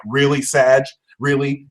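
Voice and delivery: normal talking voice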